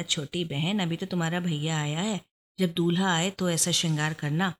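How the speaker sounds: ordinary delivery, nothing marked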